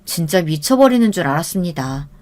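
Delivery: usual speaking voice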